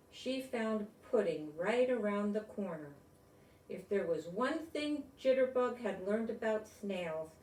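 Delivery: bright, storybook read-aloud voice; reading to children. Acted. Grown-up reading a picture book to kids, storybook voice. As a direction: read it like bedtime story time.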